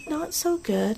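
put-on small, high child's reply; a hint of a tired child; weak child voice. Sick child answers on phone.

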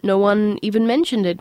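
plain reading voice

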